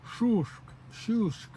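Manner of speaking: Shushk with the tongue moving up toward the roof; clearly shape Shushk for the learners; careful demonstration. Shown as a demonstration. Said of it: "notice which way your tongue moves".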